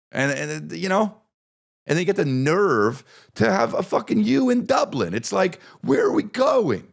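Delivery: high, rising outraged rant; exasperated rant. Acted. Comic mocking Irish font, shouting in disbelief. Ranting about Dublin font.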